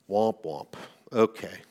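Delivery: low, deflated sad-trombone noise; mock letdown. Preacher reacting to the king's harsh answer, mock disappointment.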